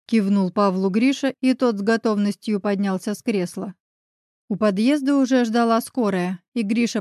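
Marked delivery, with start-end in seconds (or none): none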